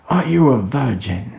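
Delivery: shouted question. Acted. Kelston repeating his question at Tim, shouting. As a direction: bellow the question right at him. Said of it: "Kelston shouted in his face".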